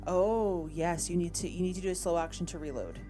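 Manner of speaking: normal talking voice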